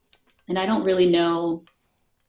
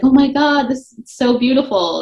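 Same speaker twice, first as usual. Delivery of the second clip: moved; 6 semitones higher, 5 dB louder than usual. Retold or imitated. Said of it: "I had, like, an emotional response".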